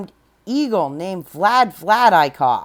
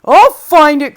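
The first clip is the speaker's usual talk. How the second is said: much louder, higher vow; determined cry; 6 semitones higher, 13 dB louder than usual. Acted. Horton vowing to find his friends, loud determined cry. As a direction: cry out a stubborn promise. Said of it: "cried Horton".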